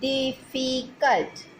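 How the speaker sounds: difficult drawn out slowly, syllable by syllable; teacher stretching difficult to show its syllables; slow syllable demo. Shown as a demonstration. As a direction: count out difficult slowly for the class. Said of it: "three syllables".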